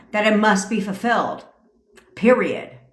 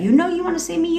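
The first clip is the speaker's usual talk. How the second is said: high teasing; 9 semitones higher, faster than usual. Shown as a demonstration. Coach playfully demonstrating a high teasing affirmation. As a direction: pitch jumps much higher, playful. Demoing commands, voice jumps high.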